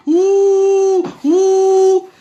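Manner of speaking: loud drawn-out booing ouh; mock booing. Leading the girls in booing irresponsible men, loud ouh. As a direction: boo them like a jeering crowd.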